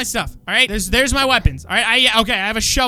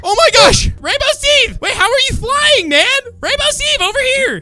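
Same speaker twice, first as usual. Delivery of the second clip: much higher and louder excited calls; excited calling; 13 semitones higher, 6 dB louder than usual. Acted. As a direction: hail your friend across the sky. Spots flying friend, calling out.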